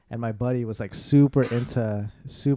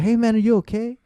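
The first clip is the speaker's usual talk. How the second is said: high, raised voice checking on a friend; re-enacted concerned shout, pitched up; acted worried calling; 10 semitones higher, 6 dB louder than usual. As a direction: call anxiously through a closed door. Calling to friend through bathroom door.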